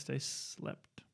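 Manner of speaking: whispered joking aside; whispering. Real, not acted. Jokey aside after "stay fed.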